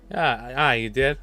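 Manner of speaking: usual speaking voice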